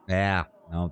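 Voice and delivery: ordinary talk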